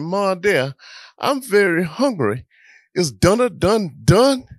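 put-on a little boy begging for dinner; imitating a hungry brother's plea; whiny child.

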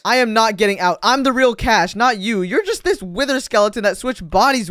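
heated, defiant assertion; indignant insistence. Acted. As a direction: insist on who you really are. Insisting he is the real one.

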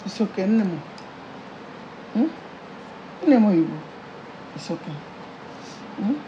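low, hushed motherly comfort; soothing. Acted. Mother comforts crying daughter, soothing. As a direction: comfort softly, with pauses.

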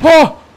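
high shocked cry; startled yelp. Real, not acted. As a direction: jolt out a short scream. Jump scare.

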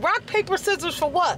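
high disbelieving question; incredulous. Retold or imitated. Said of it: "I'm like".

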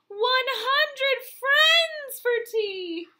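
gasping, slow and high; astonished. Acted. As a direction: stretch it out in wonder. Big surprise reveal.

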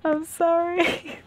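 high, drawn-out sorry; long, high apologetic wail; pleading apology. Real, not acted. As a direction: whine out a sheepish apology. Apologising to guest for bare house.

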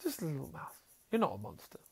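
soft, gentle relief; relieved girl. Retold or imitated. Girl finally sees it is only a mouse, soft relieved coo. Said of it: "she looks at the mouse and goes".

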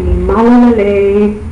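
Malalale said slowly in Tongan; Tongan word. Shown as a demonstration. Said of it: "give us a sample of your Tongan dialect".

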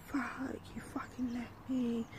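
mock whispered upset friend; whispered accusation. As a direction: hiss the accusation on the phone. Friend angry on phone.

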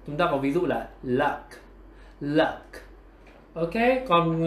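ordinary delivery

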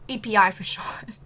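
unvoiced whisper, half to herself; whispered aside. Real, not acted. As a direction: mutter the abbreviation under your breath. Aside after embarrassing search.

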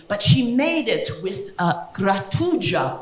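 slow, bad Italian accent; drawn-out fake Italian cooking-show host; Giada. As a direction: savour the Italian word in a mock-Italian accent. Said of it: "bad Italian accent when I'm imitating her".

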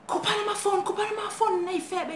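high-pitched, repetitive mock yelling; imitated shouting. Intruders demanding phones as roommates wake, mock shouting. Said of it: "they're shouting".

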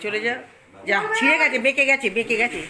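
loud, high scolding call; calling out loudly. Real, not acted. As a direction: shout instructions across the house. Mother calling out to child. (জোরে চেঁচিয়ে ডাকা)

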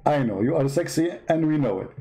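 ordinary talk, nothing marked

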